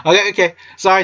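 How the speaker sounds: giggling through okay, okay; laughing. Real, not acted. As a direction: still laughing, calm down.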